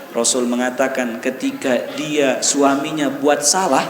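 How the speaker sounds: normal talking voice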